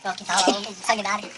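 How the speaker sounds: usual speaking voice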